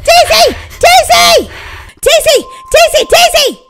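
high, loud repeated calls; yelling for sister. Acted. Scared girl calling her sister from downstairs, loud yelling. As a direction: yell her name over and over. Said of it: "why are you yelling".

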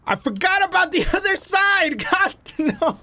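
sudden laughing shout of dismay. Real, not acted. Gamer realizing the other side is still dirty, dismayed laughing shout. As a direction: yell it, caught off guard by the extra work. Realizes half the job remains.

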